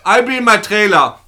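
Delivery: loud, high, slow shouting director; porn director. Pretend. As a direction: bark orders as a diva director. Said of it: "Ich wollte gerne den deutschstämmigen Porno".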